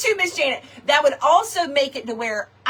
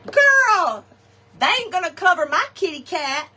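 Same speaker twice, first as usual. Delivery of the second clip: high mock sister voice, loud on girl; lightly voiced sister, high on girl; imitating sister; 7 semitones higher, 4 dB louder than usual. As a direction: quote big sister with a high sassy girl. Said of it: "My sister says".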